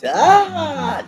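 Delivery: high loud chiming cry; imitated aha. Chiming in on the neighbour's aha to annoy the ex, high and loud. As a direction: join in with a big high aha. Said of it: "I was just chiming in".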